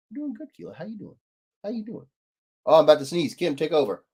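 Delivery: usual speaking voice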